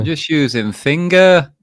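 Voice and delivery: high, loud mock northern accent; northern English accent. Echoing the board wiper excuse, mock northern accent.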